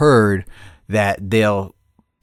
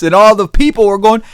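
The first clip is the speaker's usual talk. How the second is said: ordinary talk, nothing marked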